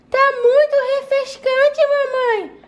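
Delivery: put-on high toddler voice; a small girl delighted; little kid voice. Child voice answering mother.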